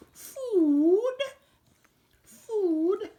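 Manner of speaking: very high, loud sing-song call of food; high dog-talk. Acted. Owner calls to his dog Jimmy in a squeaky, very high voice. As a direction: squeal the word like calling a puppy.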